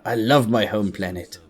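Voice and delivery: ordinary talk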